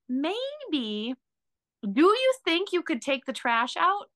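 sweetly hint around the request; indirect, pleading tone. Retold or imitated. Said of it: "instead of saying".

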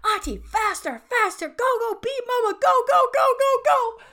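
put-on high, loud child's squeal of urging; shrieked, high-pitched cheering; excited child. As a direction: yell it like a thrilled little kid egging auntie on. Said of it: "She's so excited to be running".

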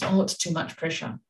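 normal talking voice

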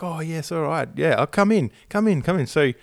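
high, bright-mocking hostess voice; imitating wife. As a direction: play her up as an eager hostess. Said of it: "Nat's like".

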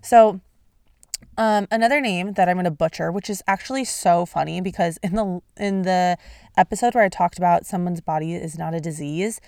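usual speaking voice

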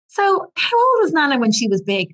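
put-on high, innocent child question; a hint of her son's curious voice; little boy voice. Mum retelling her son's question about Nana, high and innocent. Mother voices son in story.

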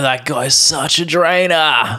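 put-on slow, drawn-out Australian accent. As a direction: stretch it out like an Aussie. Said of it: "whereas Australians".

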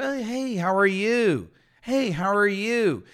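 bright high friendly hello; cheery greeting. Shown as a demonstration. Teacher acting out a casual greeting, high and lively.

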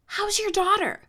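loud across bus. Retold or imitated. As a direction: shout the question for everyone to hear. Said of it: "very, very loud".

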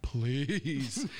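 a laughing please; relieved, laughing plea. Retold or imitated. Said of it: "I'm like".

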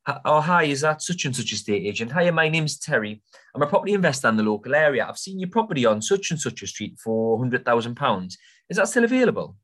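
high, sing-song up-and-down sales call; chirpy caller with a lively, swooping tone; enthusiastic. Shown as a demonstration. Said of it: "Up and down with your tone of voice. Enthusiastic".

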